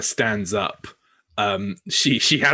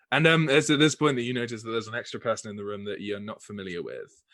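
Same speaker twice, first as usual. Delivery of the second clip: usual speaking voice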